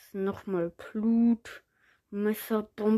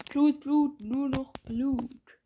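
voice changer; 4 semitones higher, slower than usual. Acted. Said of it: "und zwar mit Stimmverzerrer".

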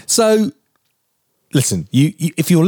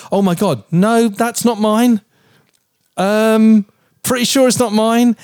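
mock panic; 8 semitones higher than usual. Husband acting out his own panic over the wrapper. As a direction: play the flustered innocent.

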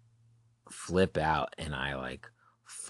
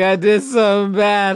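high, loud mock confession; hammed-up wail; 11 dB louder than usual. Stoned teen confessing to mom by phone, hammed-up wail. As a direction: wail it like a guilty child.

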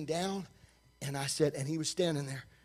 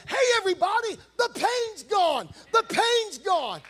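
excited shout; 11 semitones higher than usual. Retold or imitated. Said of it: "He said".

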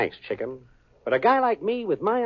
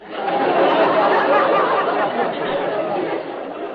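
high breathy laugh; 11 semitones higher, 3 dB louder than usual. Acted. Al laughs at being invited.

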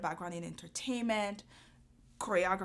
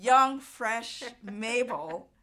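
slow, loud, higher teasing drawl; playful tease; 4 semitones higher, 8 dB louder, much slower than usual. Acted. Host teasing her guest, slow loud playful drawl. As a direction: stretch every word like a fond joke.

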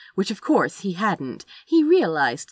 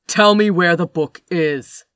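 loud, hard command; stern command; 11 dB louder than usual. A narrator's reading. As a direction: order him coldly.

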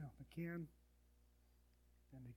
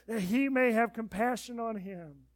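booming, high proclaimed scripture; Isaiah read like a sermon climax; loud scripture reading; 7 semitones higher, 17 dB louder than usual. Acted. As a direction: proclaim the verse to the hall. Reading scripture at full force.

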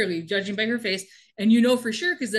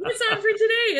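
very high, mock-eager question; fake chipper; 12 semitones higher, 4 dB louder than usual. Kimberly to the drill sergeant, all smiles, fake chipper. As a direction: ask it in an exaggerated perky pitch.

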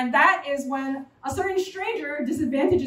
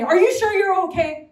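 higher, louder worried mother; nagging mom; 6 semitones higher, 6 dB louder than usual. Retold or imitated. Mother nagging her grown child, louder and higher. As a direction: press the question anxiously.

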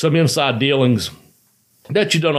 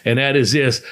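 ordinary talk

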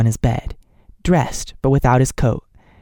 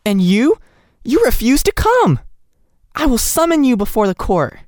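indignant threat; 11 semitones higher than usual. A narrator's reading. Nellie threatening the doctor with the court, high and indignant. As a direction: shame him into coming.

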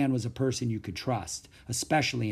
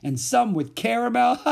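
high, loud list, breaking into laughter; gleeful high holler; laughing taunt; 10 semitones higher, 5 dB louder than usual. A narrator's reading. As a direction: crow it, then guffaw. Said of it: "As Mac continued his roll call".

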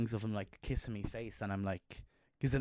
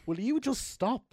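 high, louder, exaggerated parental plea; mock pleading; 14 semitones higher, 6 dB louder than usual. Parent telling his son to stop growing, high mock plea. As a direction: beg the boy to stop, half joking. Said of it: "I do say to him".

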